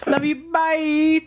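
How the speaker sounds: high sing-song echo; sing-song goodbye. Real, not acted. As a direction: chirp it back high. Host echoes caller's goodbye sweetly.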